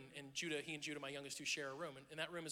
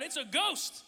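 terrified cry; 9 semitones higher, 8 dB louder, slower than usual. A narrator's reading. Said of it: "It says they were terrified".

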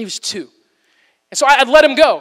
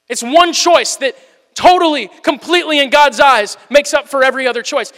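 higher, louder, driving each choice home; preaching at full force; 4 semitones higher, 4 dB louder than usual. Acted. A pastor urging the congregation toward the one choice, preaching hard. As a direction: build the repetition like a rising sermon.

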